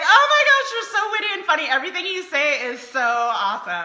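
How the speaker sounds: high, loud gushing with a laugh; mock smitten new partner. Wife mocking a new couple's gushing, squealing high. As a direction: gush like someone freshly in love. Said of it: "the new stage where you're like".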